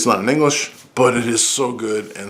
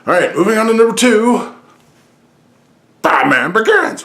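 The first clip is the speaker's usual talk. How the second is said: countdown announcer; 11 semitones higher, 6 dB louder than usual. Pretend. Host moving on to his second pick, loud announcer call. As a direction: belt out the reveal, slowing on the title.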